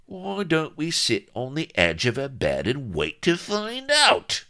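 loud, high-pitched angry snarl. Acted. Vladimir at the window to his dawdling captain, snarling. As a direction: snarl it sarcastically, climbing to a shouted last word. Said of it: "snarled Vladimir".

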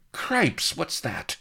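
sudden very high startled yelp; very high startled cry. A narrator's reading. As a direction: jump at the noise, voice leaping up.

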